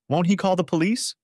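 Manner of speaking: high, anxious question. A narrator's reading. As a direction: ask it with rising worry. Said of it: "she asked anxiously".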